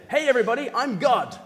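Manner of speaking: loud, high call to a crowd; mock public announcement. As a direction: proclaim it to everyone within earshot. Voices Jesus announcing himself to all.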